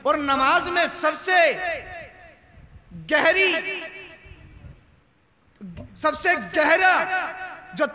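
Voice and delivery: high, ringing phrases split by long pauses; declamatory preaching. Real, not acted. Preacher stressing prostration to the crowd, declamatory preaching. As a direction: build weight phrase by phrase.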